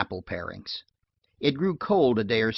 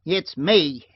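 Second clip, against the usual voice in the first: loud, high call back; shouted; 5 semitones higher, 6 dB louder than usual. A narrator's reading. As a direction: shout it through the door. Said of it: "Heppy shouted".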